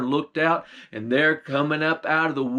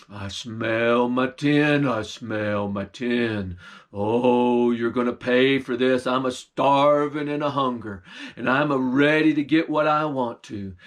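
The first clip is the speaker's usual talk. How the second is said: low bear voice; 3 semitones lower than usual. Acted. Bear sniffing out the stolen meat, low and menacing. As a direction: rumble it like a hungry bear.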